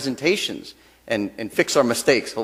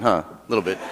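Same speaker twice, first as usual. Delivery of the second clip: ordinary talk, nothing marked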